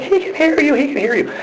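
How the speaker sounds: high-pitched mock mom voice; very high imitation of a mother's warning; imitating mom. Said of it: "she's like".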